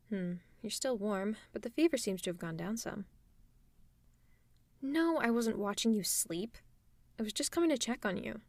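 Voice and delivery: plain reading voice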